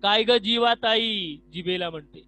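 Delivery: loud, slow, concerned questioning; caring ear character. Acted. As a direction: ask the gloomy tongue what is wrong. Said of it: "कर्णिका पहिली काय म्हणते बघा".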